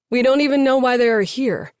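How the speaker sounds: dismissive, snide jab. A narrator's reading. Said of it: "Mateo said snidely".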